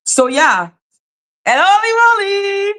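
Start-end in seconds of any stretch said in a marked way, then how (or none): 1.5-2.7 excited greeting. Real, not acted. Greeting chat member.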